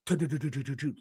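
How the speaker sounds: quick soft tu-tu-tu of footsteps; mimicked footstep patter; running footsteps sound. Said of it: "like, someone running sprints".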